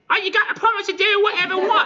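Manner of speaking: very high, loud, fast Cockney screech; atrocious Cockney hag. Acted. Crass hag demands a promise from knight, atrocious screeched Cockney. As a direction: shriek it like a hammed-up Cockney crone. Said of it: "like, kinda Cockney accent".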